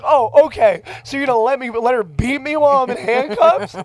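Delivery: high, loud sarcastic complaint; retold indignant protest. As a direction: appeal to the cops in outraged disbelief.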